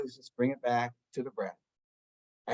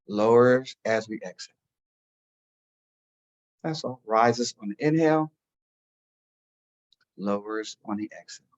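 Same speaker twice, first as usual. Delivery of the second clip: guided meditation; 2 semitones lower than usual. Acted. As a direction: talk them gently into stillness. Leads a meditation.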